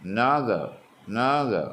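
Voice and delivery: "another" with "no" punched loud, arching up then falling; stress on second syllable. Shown as a demonstration. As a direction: hit the middle of "another", small "a" and "er". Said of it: "രണ്ടാം syllable stress". ("another": നടുവിലെ syllable ശബ്ദം കൂട്ടി)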